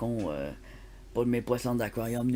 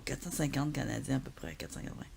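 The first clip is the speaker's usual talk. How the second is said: whisper; 8 dB quieter than usual. Real, not acted. Shocked at the price.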